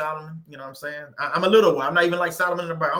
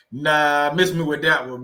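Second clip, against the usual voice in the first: ordinary delivery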